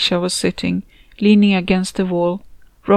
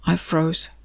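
usual speaking voice

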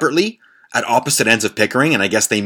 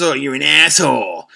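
mock beggar insult; 4 semitones higher than usual. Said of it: "and then she says".

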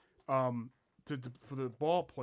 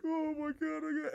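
mock sobbing; 13 semitones higher than usual. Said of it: "I'm sitting like".

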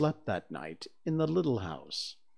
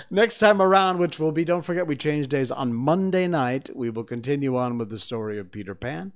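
announcement; 2 semitones higher, 7 dB louder, faster than usual. Real, not acted. Announcer outro.

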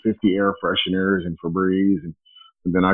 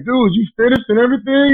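raised, incredulous voice; loud disbelieving question; 12 semitones higher, 8 dB louder than usual. Retold or imitated. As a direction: call it out in disbelief. Confronting passenger in car.

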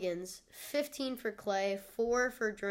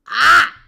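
loud sharp yelp; startled yelp; 6 semitones higher, 22 dB louder than usual. Real, not acted. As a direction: yelp as the hiccup hits. Hiccup startle.

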